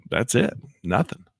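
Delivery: usual speaking voice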